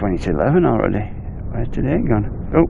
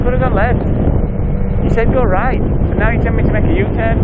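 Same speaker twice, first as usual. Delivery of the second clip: exasperated; 10 semitones higher than usual. Real, not acted. Complaining at the app.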